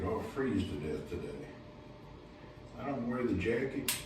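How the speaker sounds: quiet aside. Real, not acted. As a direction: mutter it while getting ready. Quiet aside while getting ready.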